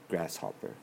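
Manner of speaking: ordinary talk, nothing marked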